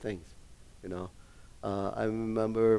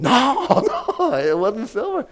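mock protest; 11 semitones higher, 7 dB louder than usual. Pastor's wife protesting her filling.

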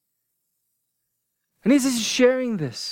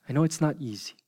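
ordinary talk

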